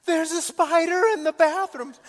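high, loud imitation of a panicked kid; mock panic. Daughter phones dad about a spider, hammed-up panic. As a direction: wail it like a terrified child. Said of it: "And she's panicked".

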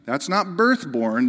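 ordinary delivery

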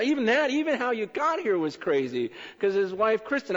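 ordinary talk

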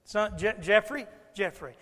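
loud worried calls of the name; calling out. Retold or imitated. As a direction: keep calling for the missing son. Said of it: "becomes more frantic".

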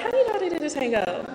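asking a dance partner, puzzled by her tango; confused surprise. Retold or imitated. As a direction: blurt it out, baffled. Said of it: "I'm just confused a little bit".

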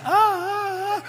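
put-on high wailing child's cry; loud wailing tantrum cry; kid screaming. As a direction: shriek like a toddler in a cart. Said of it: "And the kid just".

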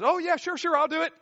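high, airy, easy promise; breezy kid. Retold or imitated. Kid lightly promises the parent, high and breezy. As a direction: toss off a careless yes.

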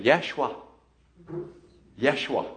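"Yeshua" as YESH-oo-ah; Hebrew pronunciation. Shown as a demonstration. Pastor gives the original name, Hebrew "Yeshua" as YESH-oo-ah. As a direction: say "Yeshua" carefully, stressed on the first syllable. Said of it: "They would say".

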